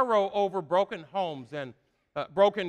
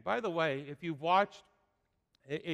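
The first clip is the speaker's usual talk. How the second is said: ordinary delivery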